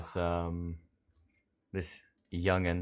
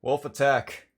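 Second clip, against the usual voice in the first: loud, high shouted answer; calling back; 8 semitones higher, 8 dB louder than usual. Acted. Kresk traveler answering a shouted question, calling back loudly.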